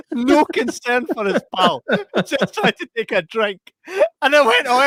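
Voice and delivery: usual speaking voice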